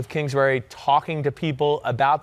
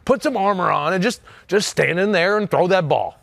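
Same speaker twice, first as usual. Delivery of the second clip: higher, urging take on his father; dad impression; 4 semitones higher than usual. Son demonstrating his dad's advice for listeners, put-on dad voice. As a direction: urge him on as Dad would. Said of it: "this is my dad voice".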